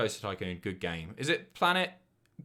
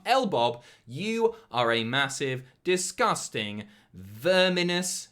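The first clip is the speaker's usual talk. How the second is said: slow, emphatic mock abuse; mock insult; 3 semitones higher, 5 dB louder, slower than usual. Streamer insulting a viewer's partner on request, drawn-out mock abuse. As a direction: draw out each insulting word, higher and louder.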